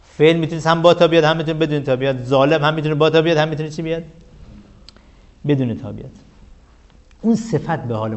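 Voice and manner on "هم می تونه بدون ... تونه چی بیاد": ordinary talk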